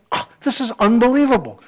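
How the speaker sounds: wide-eyed mock amazement; amazed. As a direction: gush like you can't believe your luck. Ferrari amazed at God's welcome.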